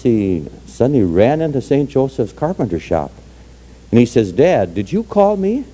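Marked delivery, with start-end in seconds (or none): 4.3-5.7 put-on high, slow child's questioning voice; little boy. Boy running into Joseph's carpenter shop, high childlike question. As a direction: ask like a small boy, pitched way up.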